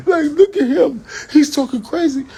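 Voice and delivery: very high, loud mock-crying mother; mama's voice. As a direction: wail it up high like an exasperated mom.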